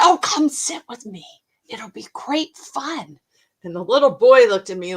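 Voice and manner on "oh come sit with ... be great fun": warm invitation. Retold or imitated. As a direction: coax a shy child over. Inviting a shy boy.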